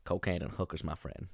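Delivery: low, very soft film-star delivery; Matthew McConaughey impression. Podcaster quotes the film to co-host, low soft impression. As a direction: imitate the actor, confiding and low. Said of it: "doing a Matthew McConaughey accent".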